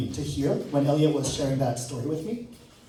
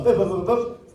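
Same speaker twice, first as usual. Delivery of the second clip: quick mock keypad boops; playful phone-dialling noises; imitated dialling beeps; 5 semitones higher, 5 dB louder than usual. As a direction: boop out the phone digits.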